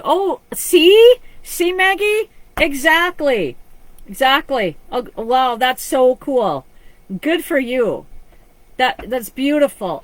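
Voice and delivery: real delighted excitement at the viewer's message. Real, not acted. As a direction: gush over the good news. Reacting with delight to viewer comment.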